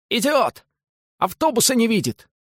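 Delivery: high furious shriek. A narrator's reading. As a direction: shriek it out in outrage. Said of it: "визжал Паниковский".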